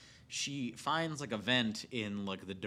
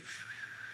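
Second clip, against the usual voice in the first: imitated whistle; 9 dB quieter than usual. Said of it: "He just goes".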